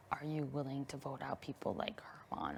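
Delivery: hushed whispered question. Retold or imitated. Quoting Kevin's secret question.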